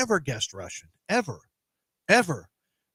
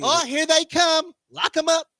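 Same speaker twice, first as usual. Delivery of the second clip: high, drawn-out warning call; mock alarm call; 10 semitones higher than usual. Someone spotting visitors warns the house, mock alarm call.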